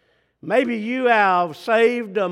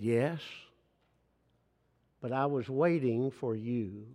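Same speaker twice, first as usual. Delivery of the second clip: much softer and lower, barely there; soft small-child voice; 7 semitones lower, 10 dB quieter than usual. Retold or imitated. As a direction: say it gently like a sleepy little girl. Daughter's reply, voiced softly.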